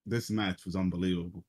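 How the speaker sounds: usual speaking voice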